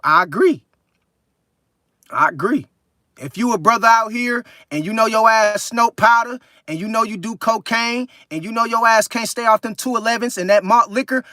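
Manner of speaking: usual speaking voice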